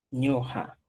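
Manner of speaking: "ñoja" with a jota sound, low and soft; Chanca form. Shown as a demonstration. Teacher models Chanca "ñoja" softly for the class. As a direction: say "ñoja" with a harsh j in the middle. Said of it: "y en quechua chanca digo".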